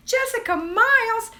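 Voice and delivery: shrieking the name high and loud; excited. Real, not acted. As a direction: squeal the name out. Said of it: "I got my first greeting".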